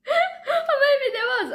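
very high, drawn-out laughing wail; crying with laughter. Real, not acted. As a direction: squeal it slowly through laughter. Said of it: "I'm, I'm crying".

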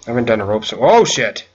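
ordinary delivery

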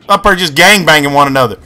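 normal talking voice

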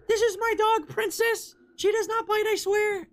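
very high falsetto owner impression; dog owner lady. Streamer voices owner as skin creature passes, high falsetto. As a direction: squeak a mock defence of your dog. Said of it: "some lady".

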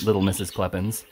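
ordinary delivery, nothing marked

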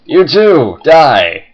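loud gleeful villain taunt; mad scientist. Pretend. Gleeful villain taunting falling pieces.

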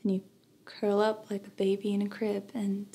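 soft, grieving narration fading out; tragic heroine sorrow. A narrator's reading. Somber memoir narration of death.

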